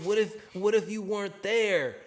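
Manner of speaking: egging him on. Retold or imitated. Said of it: "I'm egging it on".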